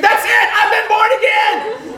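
high, loud, exultant yell; joyful scream. Retold or imitated. Professor realising she is born again, screamed. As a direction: scream the revelation out. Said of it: "And Nikki screamed".

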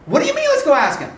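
high, loud mock disbelief; incredulous retort. Acted incredulous reply in retold exchange.

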